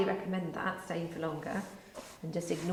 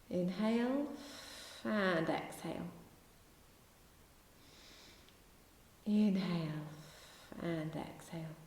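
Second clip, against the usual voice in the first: slow, calm yoga breath instructions; guided breathing cues. Acted. Yoga teacher guiding class through breathing, calm and slow. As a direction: count the class through each breath gently.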